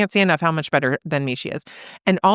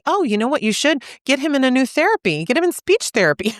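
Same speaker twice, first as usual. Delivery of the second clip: higher-pitched, eager mock advice; imitated unhelpful advice-giver; 7 semitones higher than usual. Someone trying to solve a mother's problem, imitated pushy advice. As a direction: jump in with a fix, pitched up. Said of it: "She wasn't gonna tell me".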